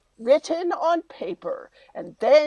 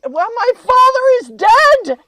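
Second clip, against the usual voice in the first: dramatic wail; 11 semitones higher, 10 dB louder than usual. Acted. Lady announces grief dramatically.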